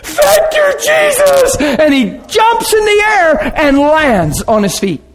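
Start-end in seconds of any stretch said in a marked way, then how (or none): 0.0-1.6 high, tearful shout of thanks; overjoyed cry. Retold or imitated. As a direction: cry it out as a healed man overwhelmed. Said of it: "and went".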